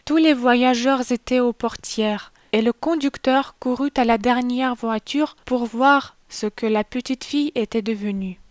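usual speaking voice

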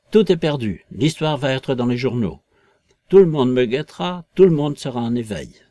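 loud, indignant lament; violent ranting. A narrator's reading. Invisible man raging that all is lost, violent ranting. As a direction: burst out hard at the start of each phrase. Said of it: "Ce discours se continua par des imprécations violentes".

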